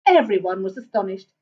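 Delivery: plain reading voice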